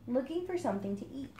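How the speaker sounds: ordinary delivery, nothing marked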